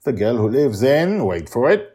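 teasing, loud announcer build; dramatic build-up. Acted. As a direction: hype it like a showman. Dramatic podcast intro.

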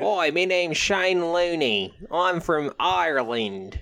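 slow, high hammed-up Irish accent. Podcaster introducing Shane Looney to cohosts, hammed-up Irish accent. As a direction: lay the Irish brogue on thick and slow. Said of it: "How do you do an Irish accent".

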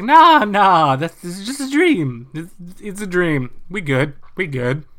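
loud, high brush-off; mock reassurance. Professor telling Jean it was a dream, loud high brush-off. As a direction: wave it away a bit too hard. Professor dismissing Jean's vision.

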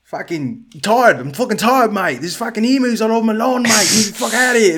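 loud, high, hammed-up Aussie grumbling; mock Australian accent. Teasing his Australian neighbour, loud mock Aussie accent. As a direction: roast him with an exaggerated Australian rant. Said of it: "roast the shit out of them with accents".

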